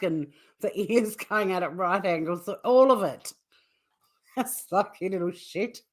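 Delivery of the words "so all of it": ordinary talk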